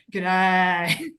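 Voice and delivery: put-on Australian accent, laughing through it; hammed-up Aussie greeting; playful Australian G'day; mock Australian G'day. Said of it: "That accent, you're gonna hear that accent".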